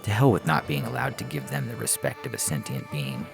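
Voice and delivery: ordinary delivery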